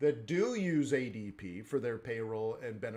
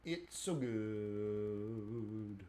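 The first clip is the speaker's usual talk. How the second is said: slow savouring; 4 semitones lower, 8 dB quieter, much slower than usual. Real, not acted. Savouring, drawn out.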